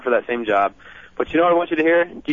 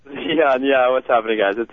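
normal talking voice

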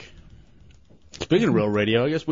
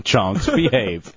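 ordinary delivery

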